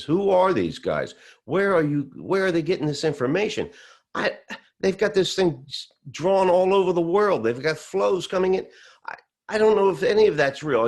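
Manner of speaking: very high, loud, incredulous retelling; agitated disbelief. Retold or imitated. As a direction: act out a stunned old man protesting. Acting out distraught father-in-law in denial.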